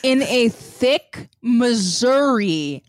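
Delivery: slow, deliberately stretched repeat; drawn-out echo. Acted. Co-host echoes the fan fiction line back, slow and drawn-out. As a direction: stretch each word out, lingering on it. Listener mockingly echoes the phrase slowly.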